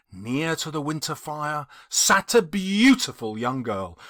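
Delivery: usual speaking voice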